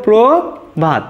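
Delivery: প্রভাত said slowly, প্র then ভাত; correct pronunciation, slow. Shown as a demonstration. Teacher modelling the word slowly for students. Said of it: "এটাই আমাদের শুদ্ধ উচ্চারণ".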